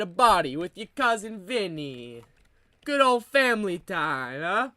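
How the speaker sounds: broad mock Italian-American accent, playing a wiseguy. Comedian riffing on family life in an Italian accent. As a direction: lay on a thick Italian accent like a mob cousin. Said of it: "the Italian accent".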